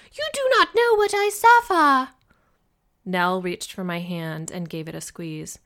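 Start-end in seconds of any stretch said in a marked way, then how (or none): 0.1-2.1 high, loud, wailing shriek; shrieking mother. Acted. Wife wails to husband about her suffering, high and loud. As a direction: cry out in dramatic suffering. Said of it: "Missus Bennett shrieked".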